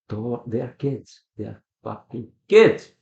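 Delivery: slow, loud exasperated outburst; worked-up rant. Real, not acted. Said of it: "without getting too worked up, ranting too much".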